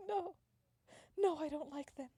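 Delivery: high, loud acted protest; emphatic denial. Acted denial before whispered confession.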